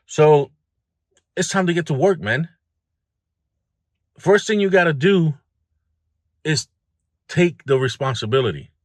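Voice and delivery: loud rallying push; fired up. Real, not acted. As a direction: get them moving, coach-style. Pep talk, raised voice.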